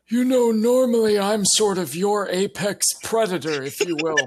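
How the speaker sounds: high-pitched, put-on Santa. Santa looking down at the gingerbread men, put-on high voice. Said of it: "as Santa, I will kind of look down at them and say".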